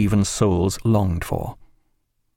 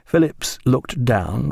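ordinary delivery

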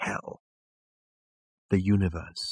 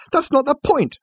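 very high, loud objection; exasperated high-pitched retort; protesting; 8 dB louder than usual. Acted. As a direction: burst out in frustrated protest. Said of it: "Arthur protested".

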